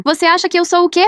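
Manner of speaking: high, hurt cry of disbelief; hurt outburst. Acted. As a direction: fling the question back at her mother. Emily confronting her mother.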